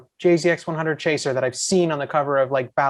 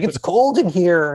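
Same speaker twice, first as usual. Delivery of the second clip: annoying complainer; 3 semitones higher, 4 dB louder, slower than usual. Retold or imitated. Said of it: "probably being annoying even and being like".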